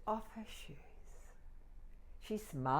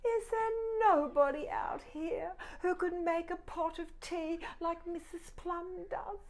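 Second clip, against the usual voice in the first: high plaintive teapot voice; 12 semitones higher, 5 dB louder than usual. Acted. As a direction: sound a little lost and hopeful. Teapot character asks sadly.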